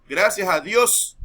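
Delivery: "Gracias a Dios" slow and loud, the S at the end of Dios dropped; loud, slow "Gracias a Dios" without a final S. Shown as a demonstration. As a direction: drawl "Gracias a Dios", swallowing the S. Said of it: "En vez de decir gracias a Dios".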